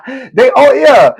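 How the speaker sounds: laughing through the words; can't hold the laugh in; real laugh breaking into words. Real, not acted. Laughing at own joke.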